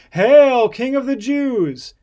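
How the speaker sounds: loud, high, drawn-out mock hail; mocking salute. A narrator's reading. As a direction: hail him in mockery, loud and slow. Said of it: "after they had mocked him".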